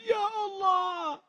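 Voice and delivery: high, drawn-out mock wail; imitated tearful wail. Preacher showing how Ustadz Lukman makes crowds cry, imitated wail. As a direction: stretch it into a high pleading cry, acted. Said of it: "mengajak kita untuk menangis".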